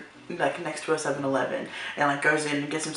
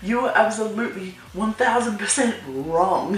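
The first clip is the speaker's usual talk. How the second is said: high-pitched incredulous comeback; indignant retold retort; mock indignation; 4 semitones higher than usual. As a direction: act out being appalled, pitch jumping up. Retold outrage at his proposition.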